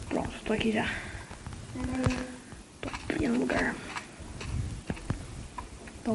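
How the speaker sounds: hushed whisper while sneaking. Real, not acted. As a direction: whisper so nobody hears. Sneaking, unvoiced.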